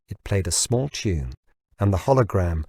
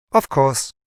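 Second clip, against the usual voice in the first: assistant voice; 5 semitones higher, 3 dB louder than usual. Acted.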